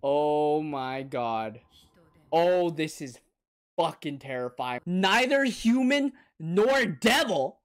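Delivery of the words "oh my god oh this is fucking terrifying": ordinary talk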